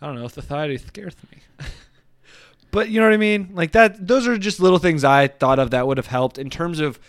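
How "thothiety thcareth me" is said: soft lisp on "Society scares me", s as th. Pretend. Host joking back about the lisp, softly lisped "Society scares me". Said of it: "Why do you have a little lisp".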